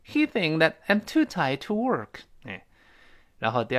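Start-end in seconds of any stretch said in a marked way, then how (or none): 0.0-2.2 slow, higher model reading, words linked; model sentence. Shown as a demonstration. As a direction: read "He think that" slowly with a weak, clipped "that". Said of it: "再来一遍".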